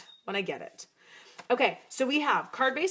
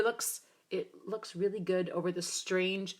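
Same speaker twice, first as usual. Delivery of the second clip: ordinary talk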